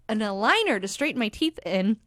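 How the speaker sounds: usual speaking voice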